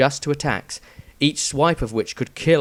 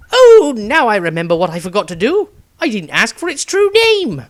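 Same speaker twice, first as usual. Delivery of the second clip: much higher and louder, Maestro squawking; comic slap-to-the-head outburst; shrill high-pitched wail of forgetting; exasperated realization; 10 semitones higher, 9 dB louder than usual. A narrator's reading.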